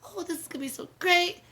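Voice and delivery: retold giddy delight, pitched high; mock excited gushing. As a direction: gush like a new girlfriend who thinks he loves her food. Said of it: "here I was all excited".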